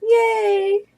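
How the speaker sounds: high squealed yay; a sudden high squeal of joy; excited squeal. Real, not acted. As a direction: squeal with delight. Excited over baby clothes.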